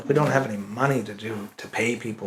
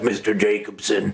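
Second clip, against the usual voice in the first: character voice; 6 semitones lower than usual. Retold or imitated. Another character Ben voices for the show, lightly done. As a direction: lower mock character voice. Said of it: "And he also does, like".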